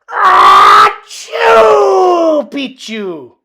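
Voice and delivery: loud fake sneeze with the word; sneezed password. A narrator's reading. Kitten sneezing the password into the dog's ear. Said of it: "half sneezed and half spoke".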